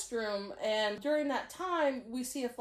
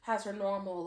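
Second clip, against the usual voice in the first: usual speaking voice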